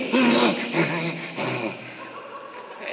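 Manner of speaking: long laugh trailing down; creepy character laugh. Acted. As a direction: let a villain's laugh fade out slowly. In Lorre scream impression.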